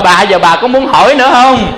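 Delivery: mock taunting shout. Husband beating wife, taunting her.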